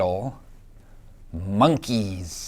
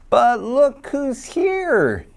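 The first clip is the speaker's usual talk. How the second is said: much higher, slow, wondering reveal; delighted surprise; 12 semitones higher, slower than usual. A narrator's reading. Reading the twist to a toddler, a high delighted reveal. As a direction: gasp and unveil Granny Ape.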